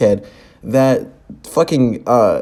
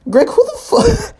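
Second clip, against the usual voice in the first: shrill, much higher disbelieving cry; incredulous outburst. Real, not acted. Reactor outraged at Greg going for the kiss, shrill disbelief. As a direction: shriek it in disbelief.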